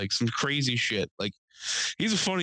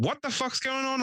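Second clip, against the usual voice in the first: high-pitched irate demand; mad as hell; 12 semitones higher than usual. Retold or imitated. Old neighbor coming down to find out, high and irate. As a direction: imitate a cranky old man. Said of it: "Like, mad as hell".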